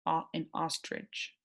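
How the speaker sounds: soft "Ah" as in ostrich; quiet vowel demo. Shown as a demonstration. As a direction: say "Ah, an ostrich" gently, vowel open. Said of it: "sounds like ah".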